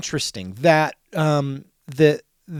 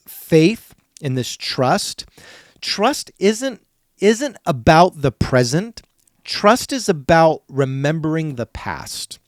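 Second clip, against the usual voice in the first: slow, louder, stressing trust; emphatic preaching; 5 dB louder, slower than usual. Real, not acted. Preacher pressing the congregation on trust, emphatic. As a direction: hammer home each word about trust. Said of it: "preacher driving home point".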